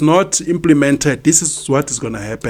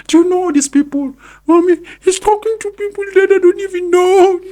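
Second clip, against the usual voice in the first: put-on very high, louder little girl's voice with a laugh; imitating his daughter; 3 dB louder than usual. Quoting his daughter complaining to mommy, very high. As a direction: squeak it like a puzzled young daughter. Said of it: "my daughter always, you know, says".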